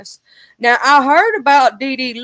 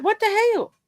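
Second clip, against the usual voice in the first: mock shock; 10 semitones higher than usual. Reacting in disbelief to chat news.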